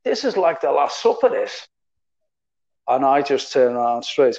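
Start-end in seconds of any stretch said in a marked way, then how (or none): 0.0-1.7 put-on a light, higher Scouse accent, teasing. Sammy Lee quipping at the team dinner, light Scouse accent. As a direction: joke it in a little Scouse accent. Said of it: "little Scousey accent".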